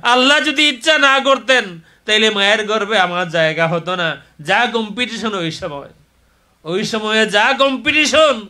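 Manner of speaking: loud, high impassioned sermon; full-force preaching. Real, not acted. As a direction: preach it out to the whole crowd.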